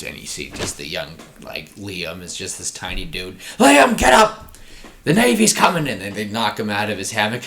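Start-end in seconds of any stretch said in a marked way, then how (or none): 3.6-5.9 high, loud shout to wake him; urgent wake-up call. Pretend. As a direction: yell at the sleeping kid to get up. Pirate crewmate waking Liam.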